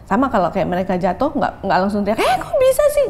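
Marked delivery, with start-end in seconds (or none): 2.2-3.1 put-on high, loud shocked shriek at a fallen child; mock shriek. Mother shrieking high at her fallen child. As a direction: shriek it up high like a panicked mom. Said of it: "Nggak gitu".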